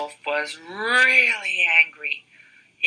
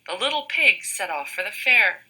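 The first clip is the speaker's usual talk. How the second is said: ordinary delivery, nothing marked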